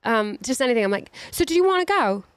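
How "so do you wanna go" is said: high, lilting mock-British question; adopted British accent. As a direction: slip into a British lilt. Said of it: "I do already. My friends make fun".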